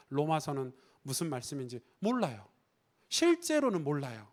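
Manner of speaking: normal talking voice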